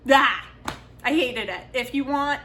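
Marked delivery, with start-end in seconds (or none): 0.1-0.5 loud exasperated "ah"; frustrated cry. Real, not acted. Reviewer venting over a hated book, frustrated outburst.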